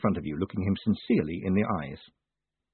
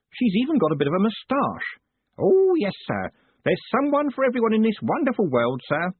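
put-on high, eager old man voice; old postman; 11 semitones higher, 4 dB louder than usual. A narrator's reading. Old Groat chatting to his boss, in a high eager voice. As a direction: chatter like a fussy old clerk.

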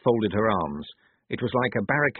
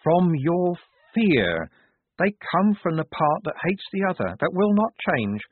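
higher, deliberate, oracular pronouncement; adult and certain; 8 semitones higher than usual. A narrator's reading. Wazza's altered voice tells where abominations come from, certain. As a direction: accuse with absolute, measured certainty. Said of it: "her voice altered, became more adult, more certain".

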